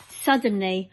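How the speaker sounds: ordinary delivery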